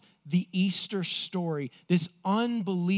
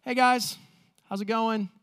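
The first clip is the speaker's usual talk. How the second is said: casual greeting; 5 semitones higher than usual. Retold or imitated. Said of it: "he's like".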